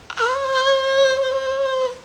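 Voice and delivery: high thin shriek; mock high shriek. Bystander shrieking at chaos, high mock shriek. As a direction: shriek like someone startled.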